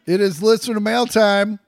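loud, bright announcement; announcer voice. Acted. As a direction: announce the segment like a host. Announcing the segment.

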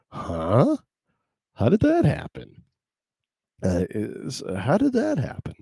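high disbelieving how did that happen; baffled, pitch climbing high; incredulous. Retold or imitated. Incredulous inner voice.

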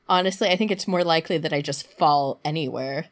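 normal talking voice

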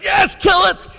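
a sudden high, loud yell of yes; shouted surrender. Retold or imitated. Man finally tells the angel to kill it, shouting. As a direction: burst out, giving in at last.

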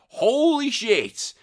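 high, slow, drawn-out shock; shocked exclamation. Real, not acted. Host reacting to a viewer smashing a GameCube, shocked. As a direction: gasp it out in disbelief.

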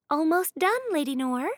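put-on bright servant girl voice; maid's voice. A narrator's reading. Maid Annabeth voiced in story.

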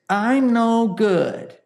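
slow, drawn-out whine; whiny voice. Shown as a demonstration. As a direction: whine it like a sulky child. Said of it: "use a whiny voice".

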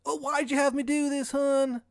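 put-on high, loud Maryland accent, really mad; Shithead Steve, bad Maryland accent. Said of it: "His bad Maryland accent".

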